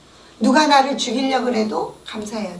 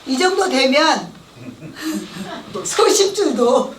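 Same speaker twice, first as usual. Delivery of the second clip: ordinary delivery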